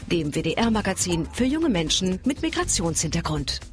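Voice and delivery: ordinary delivery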